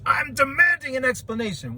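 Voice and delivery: high, put-on indignant voice; mock demanding viewer. Mimicking viewers demanding an explanation.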